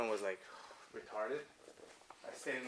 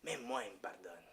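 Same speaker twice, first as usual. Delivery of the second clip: put-on priest's French accent; 4 dB quieter than usual. Priest confiding his own forgiveness, imitated French accent. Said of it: "he looks at me, he goes".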